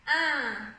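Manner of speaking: "un" as one long, loud nasal vowel; nasal sound demo. Shown as a demonstration. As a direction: hold the nasal vowel of "un", no n heard. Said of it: "Son nasal, on a le".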